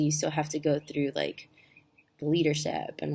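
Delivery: ordinary delivery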